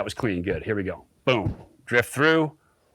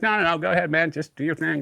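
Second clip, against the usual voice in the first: raised, easy-going decline; mock polite refusal; 5 semitones higher than usual. Turning down Craig's offer, a bit high. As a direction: wave him on cheerfully. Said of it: "I'm like".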